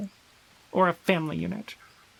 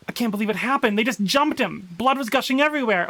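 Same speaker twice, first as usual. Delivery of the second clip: panicked survivor; 3 semitones higher than usual. Acted. Player voicing a survivor's journal, frantic and panicked. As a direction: read it like you just escaped an attack.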